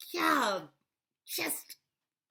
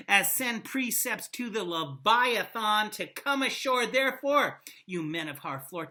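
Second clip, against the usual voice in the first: loud, declaiming Shakespeare read; dramatic recitation; 6 dB louder than usual. Acted. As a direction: proclaim it like a king at the gates. Reads Henry V speech aloud.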